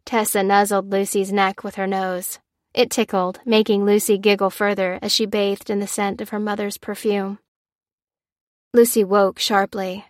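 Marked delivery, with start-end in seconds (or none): none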